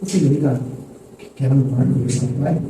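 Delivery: put-on low and soft, coaxing a little girl; tender father. Father softly calls his four-year-old to talk. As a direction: gently invite her to talk. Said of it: "Eu faço isso com a minha menina".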